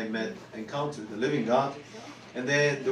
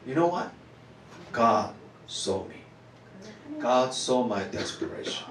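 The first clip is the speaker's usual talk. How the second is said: usual speaking voice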